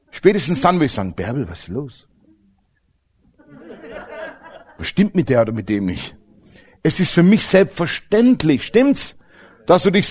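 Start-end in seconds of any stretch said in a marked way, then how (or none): 1.2-6.2 much softer, lower, puzzled aside; aside to wife. Retold or imitated. Husband asking wife Bärbel about odd guest, low aside. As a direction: mutter to Bärbel in disbelief.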